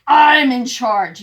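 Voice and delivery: very loud, slow bossy shout. Acted. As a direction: bellow it to claim the top spot. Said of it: "You see him shouting there?".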